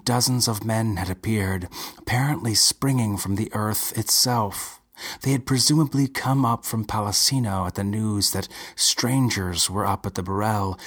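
ordinary delivery

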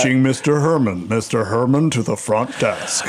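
flat PA page voice; intercom announcer. Pretend. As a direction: read it like a store loudspeaker. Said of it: "your most butch voice you can do?".

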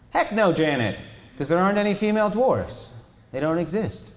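high, loud incredulous jab; high scoffing retort; Elliot's outburst. Acted.

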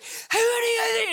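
high, loud challenge with a hint of a cry; retold angry shout. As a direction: bark it at the man who pushed the driver. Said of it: "and he's like".

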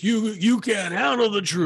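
imitating Jack Nicholson's famous line. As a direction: belt it like a courtroom showdown. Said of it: "Jack Nicholson says".

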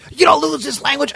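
imitated high-pitched retort; indignant lady. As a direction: mimic her high, offended comeback. Lady snaps back in quarrel.

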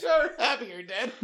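laughing through the words. Real, not acted. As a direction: repeat it while cracking up. Repeats punchline while laughing.

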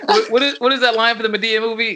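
high, eager asking; straining to recall; excited question. Real, not acted. Excited asking about Madea line.

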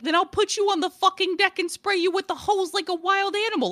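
high-pitched mock-outraged rant; exasperated threat. Mom threatening her splashing son, retold exasperated rant.

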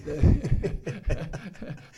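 a loud burst of real laughter; big open laugh; hearty laugh. Real, not acted. Laughing at own joke.

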